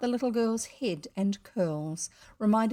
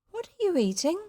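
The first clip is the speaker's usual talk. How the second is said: put-on high-pitched child's question; curious little girl; 9 semitones higher, 3 dB louder than usual. Joan asking boy about his snack, girlish.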